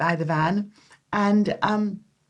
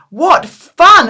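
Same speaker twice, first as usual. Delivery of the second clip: high, loud thrilled cry; delighted exclamation; 9 semitones higher, 10 dB louder than usual. Retold or imitated. Young traveller asked to visit a prison, thrilled loud cry. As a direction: burst out with glee. Invited to visit San Quentin.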